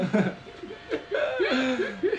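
shrill high laughter; high squealing laugh. Real, not acted. Host cracks up after retelling his nightmare, squealing.

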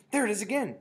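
high, loud discovery; shouted. A narrator's reading. First witch smelling it, shouted. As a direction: shout it high as you catch the scent. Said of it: "shouted the first witch".

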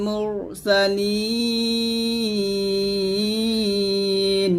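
slow chant, ইন্নাকা with long nasal hum; Quran recitation. Shown as a demonstration. Teacher reciting so students can follow. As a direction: draw the verse out melodically.

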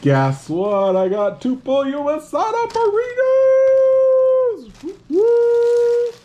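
climbing to a loud, drawn-out high squeal; excited celebration. Real, not acted. Vlogger reveals two burritos, excited rising squeal. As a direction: build from news to whooping falsetto.

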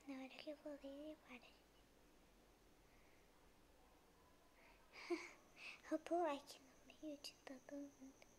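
soft unvoiced whisper. Real, not acted. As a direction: keep it to a whisper. Whispering commentary during game.

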